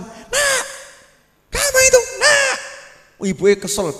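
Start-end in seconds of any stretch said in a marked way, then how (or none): 0.0-2.6 high-pitched exasperated mock yell; mock motherly yelling. Preacher acting a mother annoyed with her bouncy child, yelling high. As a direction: imitate a fed-up mother shrieking at her child. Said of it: "bengak-bengok".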